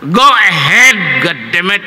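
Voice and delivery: loud, slow mock professor curse; angry professor. Professor losing patience in class, loud and slow. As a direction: push the student impatiently. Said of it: "Naggalit lalo".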